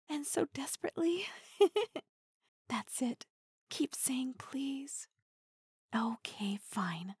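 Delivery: intimate teasing whisper; close, breathy-free hushed tease. Acted. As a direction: lean in and whisper it.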